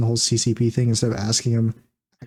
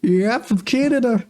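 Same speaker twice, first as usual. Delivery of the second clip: mock senator; 10 semitones higher than usual. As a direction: gloat like a caught-out liar.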